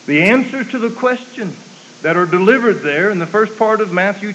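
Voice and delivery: high, loud preacher's cadence; preaching at full force. Acted. Preacher telling the congregation of the Pharisees, full-force preaching. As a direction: ring it out to the congregation. Preacher building through scripture.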